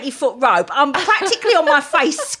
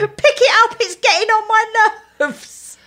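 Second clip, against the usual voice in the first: mock imperious boss; 8 semitones higher than usual. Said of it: "Miranda Priestley moments".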